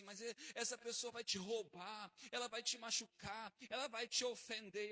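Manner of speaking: worried onlookers warning the preacher, rising loud. Retold or imitated. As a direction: build the alarm line by line.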